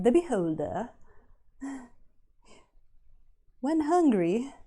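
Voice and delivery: British nature documentary narration; posh British wildlife narrator voice. Retold or imitated. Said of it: "the British voice from, uh, from National Geographic".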